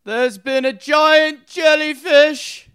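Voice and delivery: high, slow, booming proclamation; Roman orator voice. Shown as a demonstration. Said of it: "in your Roman orator voice".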